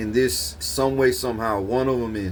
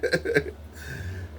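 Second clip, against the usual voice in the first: soft unvoiced laugh; breathy laugh; 4 semitones higher, 7 dB quieter than usual. Real, not acted. Laughing at his own dream story, soft breathy laugh. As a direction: chuckle under the breath.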